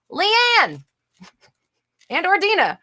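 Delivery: high, loud singsong call. Real, not acted. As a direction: holler for your assistants by name. Calls out to off-screen helpers.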